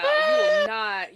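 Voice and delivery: very high, slow, stretched "you will not"; dramatic diva voice. Pretend. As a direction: wail it like a soap diva. Mock dramatic protest.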